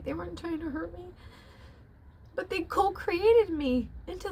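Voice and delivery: high, tearful confession; crying. Real, not acted. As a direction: push the words out through tears.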